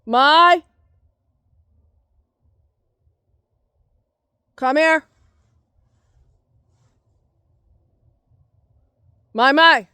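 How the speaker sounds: loud, high-pitched summons; calling out. Real, not acted. Calling the one who wandered off, loud and high. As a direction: call loudly for someone out of sight.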